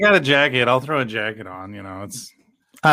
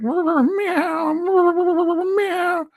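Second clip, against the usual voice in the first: cat meowing. Retold or imitated. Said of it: "going like".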